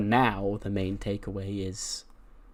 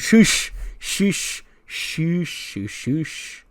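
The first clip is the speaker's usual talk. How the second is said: mock hushing; 7 semitones higher, 6 dB louder, much slower than usual. [name] hushing the others.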